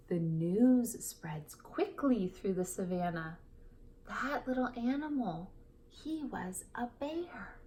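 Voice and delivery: put-on lively read-aloud to children; storybook reading. Teacher reading a picture book to kids, lively.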